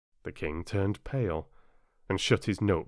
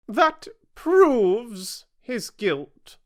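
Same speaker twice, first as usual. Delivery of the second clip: high, loud, very slow queen; imperious queen; 9 dB louder, much slower than usual. A narrator's reading. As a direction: pronounce guilt grandly. Queen declares.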